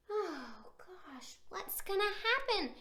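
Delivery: hushed whisper of suspense. Acted. Reader whispering to children, building suspense. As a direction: whisper it to the kids.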